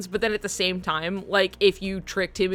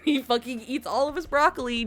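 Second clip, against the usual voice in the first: ordinary delivery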